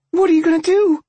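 high, loud, alarmed question; frightened question. A narrator's reading. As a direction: blurt it out in fear. Girl and Sam ask together.

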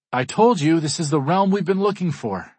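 high, loud, gushing show of excitement; fake enthusiasm. A narrator's reading. Friend covering for Kat's shock, loud fake enthusiasm. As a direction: oversell it, putting on delight. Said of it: "with as much fake enthusiasm".

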